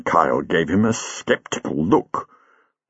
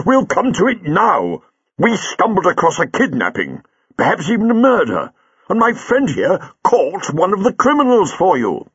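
higher, insistent echo of the sergeant; doctor insists on the crime now, insistent and higher; 10 semitones higher than usual. A narrator's reading. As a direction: turn his words back on him firmly. Doctor overrides Kyle.

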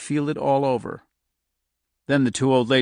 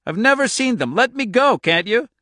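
high, loud, desperate pleading; frantic demand to be released; loud protest; 12 semitones higher, 5 dB louder than usual. A narrator's reading. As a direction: protest loudly, voice pitched way up. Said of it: "she said loudly".

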